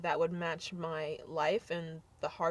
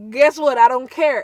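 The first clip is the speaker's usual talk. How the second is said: loud, flat-out defiant; 4 semitones higher, 14 dB louder than usual. Real, not acted. To commenters mocking her accent, loud defiance. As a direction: throw it back at the haters.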